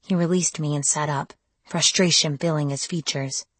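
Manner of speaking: ordinary delivery, nothing marked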